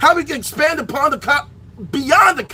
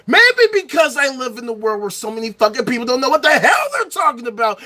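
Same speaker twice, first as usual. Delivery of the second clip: ordinary talk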